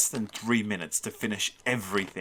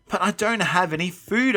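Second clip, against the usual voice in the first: higher, urgent protest; 9 semitones higher, 4 dB louder than usual. A narrator's reading. Boy to angry monsters, desperate protest. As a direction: insist with the pitch lifted.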